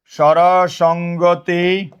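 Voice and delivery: স্বরসঙ্গতি drawn out very slowly, syllable by syllable; very slow, level স্বরসঙ্গতি; slow dictation. Shown as a demonstration. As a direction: stretch each syllable of স্বরসঙ্গতি for note-takers. Teacher repeats term slowly for dictation.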